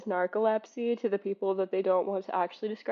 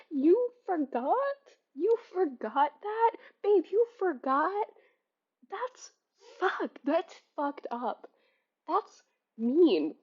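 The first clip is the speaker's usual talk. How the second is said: higher, mock-scolding disbelief; incredulous scolding; 6 semitones higher, slower than usual. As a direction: chide them like a hurt friend. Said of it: "And I said".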